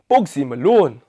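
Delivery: hammed-up bad Mancunian accent; terrible Mancunian accent. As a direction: do a badly faked Manchester accent. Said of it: "that is the worst Mancunian accent".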